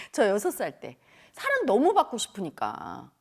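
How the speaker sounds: ordinary talk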